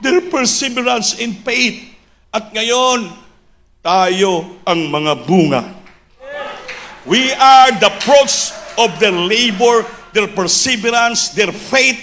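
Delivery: high, impassioned preacher's proclamation; full-force preaching. Real, not acted. Preacher extolling the pastors' perseverance to the church, full-force preaching. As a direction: build the list of sacrifices to a shout.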